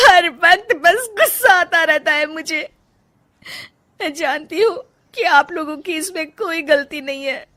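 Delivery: high, sobbing confession; anguished, tearful wail between sobs; crying. Acted. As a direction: pour out broken dreams through tears. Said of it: "Rajni फूट फूट कर रोने लगती है".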